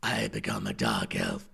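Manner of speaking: gritty low dunmer voice; dark elf voice. Shown as a demonstration. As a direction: drop into a dark elf from the throat. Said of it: "the front of my throat is where".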